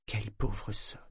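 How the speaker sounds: plain reading voice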